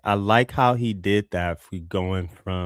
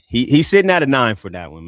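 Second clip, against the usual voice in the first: louder, firm announcement of the score; emphatic verdict; 4 semitones higher, 8 dB louder than usual. Real, not acted. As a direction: declare it proudly to the room.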